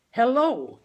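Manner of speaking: loud, high and carefully clear; father speaking crisply into the phone; distinct hello. A narrator's reading. Father greets Dan on the phone, loud and distinct. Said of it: "he said distinctly".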